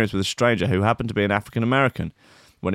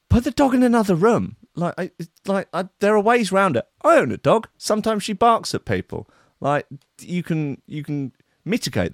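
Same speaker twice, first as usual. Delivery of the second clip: exasperated outburst; 10 semitones higher than usual. Real, not acted. Host ranting about the pastor's dog, high exasperation. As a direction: burst out in disbelief at the obvious fix.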